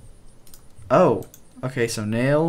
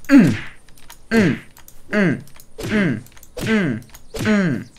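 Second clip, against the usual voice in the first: high, loud effort grunts; punching grunts; 6 semitones higher, 8 dB louder, slower than usual. Pretend. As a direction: grunt hard with each blow. Throwing punches in the game.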